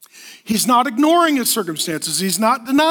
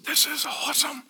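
very soft, put-on awed voice; hushed delight; 10 dB quieter than usual. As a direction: act quietly thrilled, almost under the breath. Voicing Paul delighted while writing.

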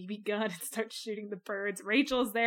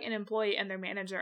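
ordinary talk, nothing marked

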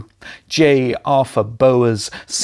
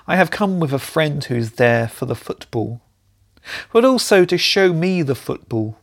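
normal talking voice

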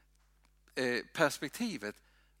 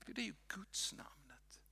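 soft whisper, much softer; reverent whisper; 6 semitones higher, 14 dB quieter than usual. Real, not acted. Preacher reveals God's name to the congregation in a whisper. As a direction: breathe it out with awe.

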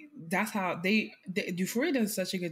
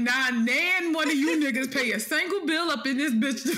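imitating mom; 6 semitones higher, 4 dB louder than usual. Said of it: "My mom would be like".

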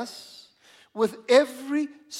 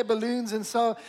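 ordinary talk, nothing marked

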